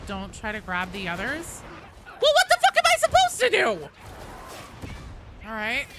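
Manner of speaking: ordinary talk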